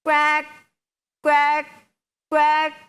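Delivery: three loud separate quacks; duck quack. Shown as a demonstration. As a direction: imitate a duck for the children. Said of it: "ആ, താറാവ്".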